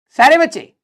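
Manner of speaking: loud call to the whole class. Real, not acted. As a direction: call the students to attention. Calling out to all students.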